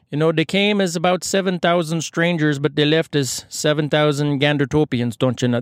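put-on Canadian accent drifting towards Indian; botched Canadian accent. Mayor summing up the strangers' stay, botched Canadian accent sounding Indian. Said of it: "little more Indian than Canadian".

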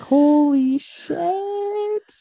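high, slow, drawn-out gasp of disbelief; stunned reaction. Retold or imitated. A viewer hearing the suspect's confession, stunned and slow. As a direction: let it stretch out in shock. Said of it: "And I'm like".

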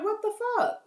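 high, disbelieving shock; can't believe what just happened; stunned. Retold or imitated. She gets out after the crash, stunned. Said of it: "I got out the car and I'm like".

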